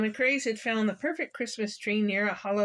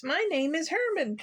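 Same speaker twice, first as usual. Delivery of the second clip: higher, lightly voiced friendly introduction; friendly skunk; 7 semitones higher than usual. A narrator's reading. Young skunk introducing himself to puppy, friendly. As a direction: introduce yourself cheerfully. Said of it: "said a friendly voice".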